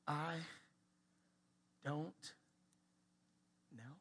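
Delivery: soft, halting, word by word; reluctant admission. Acted. Man admitting ignorance to daughter, painfully reluctant. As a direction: force out each word after a long pause. Struggles to say humiliating words.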